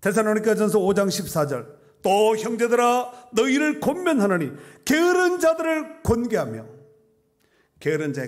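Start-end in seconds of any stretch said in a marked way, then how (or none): 0.0-6.7 high, loud ringing verse proclamation; full-force preaching. Real, not acted. Preacher proclaiming Paul's charge to admonish the idle, full-force preaching. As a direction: cry out the scripture to the whole congregation.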